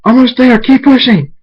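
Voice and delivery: high, loud encouragement; excited delivery-room yelling; urgent coaching shout. Acted. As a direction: urge the mother on through the last push. Urging during childbirth.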